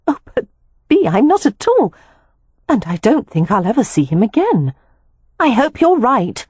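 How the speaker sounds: a hint of a young woman, brushing it off; Poppy dismissing her sister's warning, lightly voiced and uneasy.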